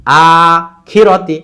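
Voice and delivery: long held আ, then quick খিরতি; stretch then quick. Shown as a demonstration. Teacher showing stretch versus speed, long আ then brisk খিরতি. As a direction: hold আ and run খিরতি together. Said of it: "আতে টান, খিরতি তাড়াতাড়ি".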